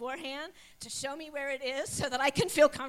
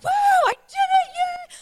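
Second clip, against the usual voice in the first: a much higher, loud whoop of joy; psyched cheer; 16 dB louder than usual. Retold or imitated. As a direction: shout it out like you just won. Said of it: "So I'm all psyched".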